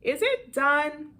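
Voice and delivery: loud, slow, high group call; loud together. Acted. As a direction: shout it at the door as loud as you can. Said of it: "as loud as they can".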